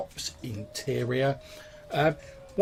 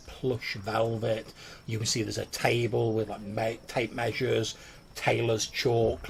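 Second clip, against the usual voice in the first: ordinary talk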